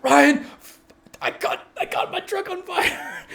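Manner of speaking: high, frantic plea for help; panicked call. Retold or imitated. Mechanic tells coworker his truck caught fire, high frantic call. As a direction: call out to him half-laughing in panic.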